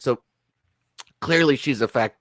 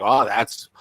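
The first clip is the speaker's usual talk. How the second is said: mock impressed onlooker; 2 semitones higher, slower than usual. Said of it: "some Mexican people be like".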